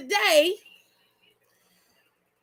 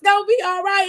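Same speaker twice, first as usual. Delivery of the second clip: higher and louder, insisting it's fine; emphatic reassurance; 5 semitones higher, 5 dB louder, faster than usual. Real, not acted. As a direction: press the reassurance up and out. Reassuring a fretting viewer again.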